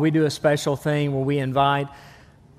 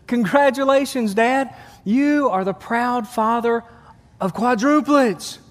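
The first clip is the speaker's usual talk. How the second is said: nurse announcing; 6 semitones higher, slower than usual. Acted.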